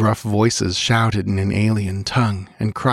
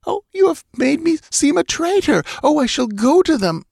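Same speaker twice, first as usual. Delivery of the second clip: anguished outrage; 4 dB louder than usual. Acted. Betrayed queen in anguish.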